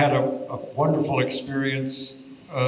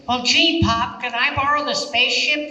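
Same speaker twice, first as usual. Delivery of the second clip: high, louder cartoon boy; cartoon kid voice; 12 semitones higher, 5 dB louder than usual. Veteran voice actor suddenly in character, high cartoon boy. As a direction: chirp it like an eager cartoon kid. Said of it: "it's suddenly".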